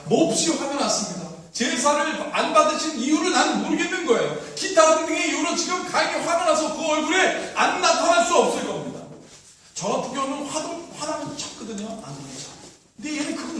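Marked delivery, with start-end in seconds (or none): 0.1-9.0 loud, raised preaching voice; forceful preaching. Real, not acted. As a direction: drive the point home loudly.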